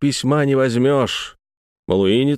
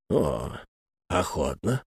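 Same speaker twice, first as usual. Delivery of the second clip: meek; 6 semitones lower, 9 dB quieter, slower than usual. A narrator's reading. Notary answers softly.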